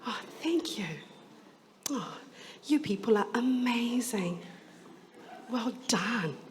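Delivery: hushed whisper to the crowd. Real, not acted. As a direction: breathe it, barely voiced. Hushing a room before performance.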